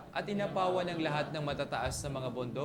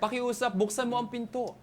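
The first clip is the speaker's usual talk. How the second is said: higher-pitched interpreted begging; shouted plea; 6 semitones higher, 3 dB louder than usual. Acted. Interpreter voices people begging to enter the ark, mildly shouted plea. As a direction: call out pleading, pitched up but only mildly loud. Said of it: "sumisigaw sila".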